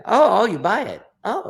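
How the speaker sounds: high, loud, delighted liar act; liar getting away with it. Acted. Liar seeing the audience laugh along, mock-surprised and high. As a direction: play a liar amazed it's working. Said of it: "he thinks he's getting away with it".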